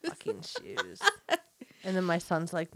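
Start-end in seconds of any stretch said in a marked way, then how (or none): 0.0-1.0 whispered through a giggle; breathy laugh on the words. Acted. Echoing a friend's joke, whispered while laughing.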